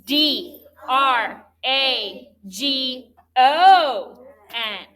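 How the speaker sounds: slow, even letter-by-letter spelling; spelled letter by letter. Shown as a demonstration. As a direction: call out each letter slowly for the class to join. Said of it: "Let's spell it in unison".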